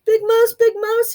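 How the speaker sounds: high, loud calling out; little mouse leaping into Big Mouse's arms; relieved cry. A narrator's reading. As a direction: cry his name with relief. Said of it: "he cried".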